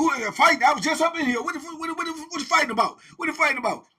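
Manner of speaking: loud, high demanding warden; warden barging in. Retold or imitated. Warden demanding to know about the fight, loud and high. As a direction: demand answers sharply. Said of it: "He run his mouth".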